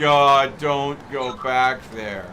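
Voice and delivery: loud, slow groaning plea; exasperated outburst. Real, not acted. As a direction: drag it out like you can't take the joke anymore. Begging friend to drop the joke.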